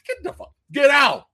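high, loud incredulous shout; hyped-up fan shout; excited shout. Real, not acted. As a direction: yell it in thrilled disbelief. Excited disbelief at trailer.

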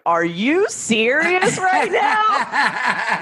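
slow, drawn-out disbelieving shout through laughter; stunned disbelief. Retold or imitated. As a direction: act amazed at getting away with it again, laughing. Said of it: "I'm like".

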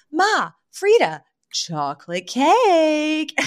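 slow singsong announcement of chocolate cake; slow enticing announcement. Retold or imitated. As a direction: dangle the cake like bait. Fran luring them with cake.

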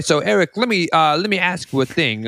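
high, halting mock New Yorker; hammed-up New York accent. Host quizzing his guest in a faltering, hammed-up New York accent. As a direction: put a question in fake New York. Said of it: "Why is the New York accent".

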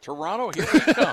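high, loud, gleeful road-trip whoop; hammed-up holiday cheer; mock cheer. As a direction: belt it out like a carefree tourist.

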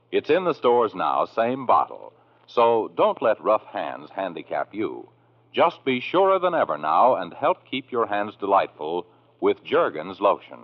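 ordinary delivery

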